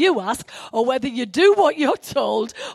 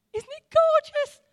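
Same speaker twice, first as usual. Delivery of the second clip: high, thrilled gush of delight; excited; 13 semitones higher than usual. Real, not acted. Preacher to congregation, delighting in a Bible passage, excited. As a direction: gush over the passage like a discovery. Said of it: "Sorry for getting so excited".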